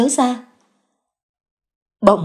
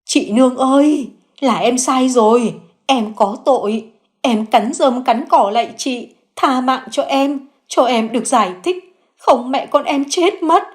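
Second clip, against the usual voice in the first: pleading in tears; 5 semitones higher than usual. Acted. Xuyến on her knees begs Nương for mercy, high and tearful. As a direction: beg for mercy through tears. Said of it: "hai chân khuỵu gối khóc lóc".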